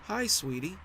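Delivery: sweet on top, hiding bad news; wife greets husband, high forced sweetness; high, unnaturally sweet. A narrator's reading. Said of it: "Her voice was high, unnaturally sweet".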